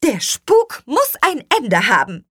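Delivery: high, loud declaration; emphatic, indignant proclamation; resolute call. A narrator's reading. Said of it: "rief Petronella".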